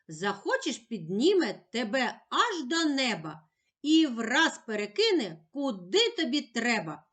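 usual speaking voice

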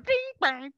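very high plinking bing bang; imitated guitar notes. Mimicking husband's bad guitar playing on stage, very high plinks.

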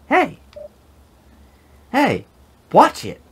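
high yelling Hey; high-pitched yell. Acted. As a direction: shout it up high. Voicing a game character yelling.

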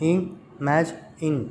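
slow "imagine" in three separate beats; syllable by syllable. Shown as a demonstration. Teacher counting syllables for children, "imagine" slowly split in three. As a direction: break "imagine" into i, ma, gine with pauses. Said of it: "three syllables".

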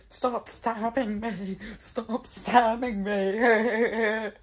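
slow, drawn-out, building wail; mock wailing plea. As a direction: plead as if being attacked. Play-acting being stabbed.

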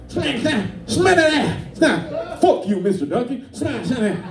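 high, garbled mock foreign gibberish; non-English-speaking student. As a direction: imitate a pupil cussing between bursts of gibberish. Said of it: "student who can't speak English".

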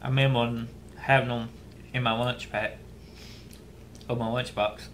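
speaking between bites; chewing while talking. Real, not acted. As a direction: mouth partly full. Seen 0: Bit chip at -5, still chewing with chip in hand.

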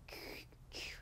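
"Q" as a voiceless puffed k-like sound; whispery bare consonant for "Q", not its name; Q letter sound. Shown as a demonstration. Teacher showing how Q sounds, "Q" as a voiceless k-like puff. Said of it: "Q는 어떤 소리가 나냐면".

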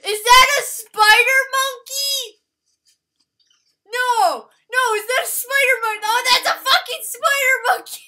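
loud, high-pitched outcry; real high yell of disbelief; shrieking alarm. Real, not acted. Alarm at spider monkey in game.

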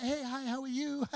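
high, too-cheery greeting; overly bright nervous hello; awkward cheerful. Acted.

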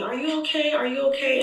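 retold doctor asking after a patient; concerned doctors. Doctors checking on crying patient.

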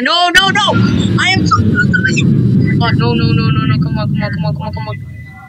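frantic pleading rising to a squeal; panicked pleading. Real, not acted. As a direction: beg the game to let you survive. About to die in game.

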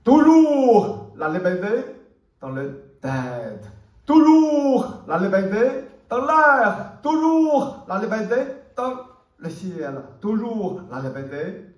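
declaimed refrain, loud and high, dipping then surging back; impassioned poem recitation. Acted. Poet proclaims liberty always, everywhere, in impassioned recitation. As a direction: call out each line with rising fervour.

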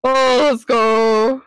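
drawn-out hype, loud and slow; excited cheer. Real, not acted. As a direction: cheer it on, stretching the words. Friend says weed might help.

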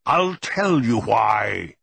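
slow, drawn-out bellow. A narrator's reading. Grandfather not waiting for grandson's answer, bellowing. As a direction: bellow it out word by word. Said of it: "he bellowed".

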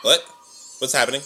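ordinary delivery

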